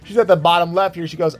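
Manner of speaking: ordinary delivery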